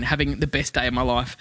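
usual speaking voice

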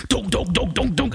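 rapid, high donk-donk stomps; thundering footsteps imitation. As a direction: imitate your own heavy marching steps. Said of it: "I've just gone thundering in".

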